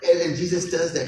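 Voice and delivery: usual speaking voice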